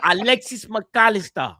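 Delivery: name announced in a mock Spanish accent. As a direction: roll out the player name like Fabrizio. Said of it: "You're still Spanish".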